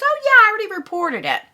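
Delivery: high, quick imitation of a friend; imitating Karen. Friend claiming she already reported it, high quick imitation. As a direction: mimic her quick reply well above your own pitch. Karen's answer in retold dialogue.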